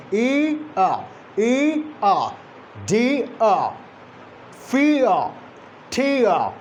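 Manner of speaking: "e, a" as "ee" moving to "uh"; gliding diphthong drill. Shown as a demonstration. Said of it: "Gliding movement from one vocalic position".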